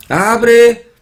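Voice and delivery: mock Korean accent, loud high a-bu-re. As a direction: imitate Koreans stretching "Abre" into three syllables. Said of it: "abre también en tres sílabas". (acento coreano imitado, a-bu-re)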